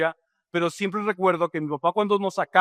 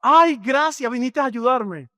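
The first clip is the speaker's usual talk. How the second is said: high, loud, delighted thanks; excited dad; 5 semitones higher, 4 dB louder than usual. Retold or imitated. Father thanks his son for coming to help, excitedly. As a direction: burst out with joy like a thrilled father. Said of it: "mi papá emocionado".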